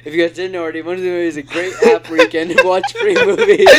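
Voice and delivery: lightly mimicked vlogger ad, cracking up; David Dobrik. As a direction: pitch the app like a YouTuber, breaking into high laughter. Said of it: "exactly how David Dobrik would do it".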